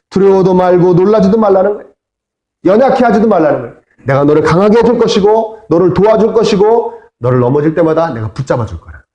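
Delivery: ordinary delivery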